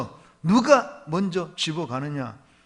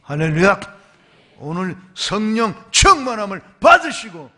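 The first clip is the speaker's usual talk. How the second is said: loud, rising pulpit delivery; fervent preaching; 5 dB louder than usual. Acted. Pastor exhorting the congregation to be Spirit-filled, fervent preaching. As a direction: preach it with fire.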